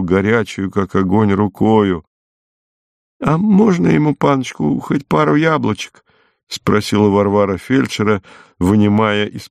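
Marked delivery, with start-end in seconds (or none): 3.2-6.0 high-pitched, hopeful request; mild pleading. A narrator's reading. Varvara asks the orderly about apples, pleading. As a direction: ask gently, pitched well up. Woman begs orderly for sick man.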